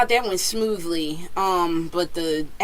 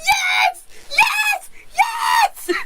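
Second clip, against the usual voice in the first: joyful screaming; much slower than usual. Retold or imitated. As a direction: scream it like your dream came true. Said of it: "I was like".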